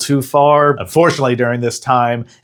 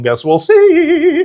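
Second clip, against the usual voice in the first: teasing sing-song; 3 dB louder, much slower than usual. Pretend.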